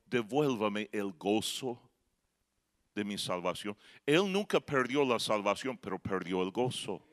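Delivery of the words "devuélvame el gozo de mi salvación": pleading prayer. Retold or imitated. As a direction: plead quietly to the Lord. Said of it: "está rogando al Señor".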